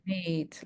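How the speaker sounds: meet with a long "ee". Shown as a demonstration. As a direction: stretch the double E in meet. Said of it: "when we have the double E".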